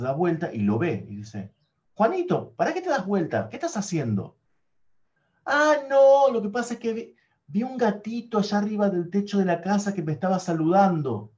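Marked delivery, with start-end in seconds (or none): 1.9-4.4 mother scolding. Acted. Mother questions boy, voiced high. 5.4-11.3 put-on high, bright-eyed little boy fibbing; a lightly voiced child making up a story; boy's excuse. Niño disculpándose ante la madre, voz aguda de niño.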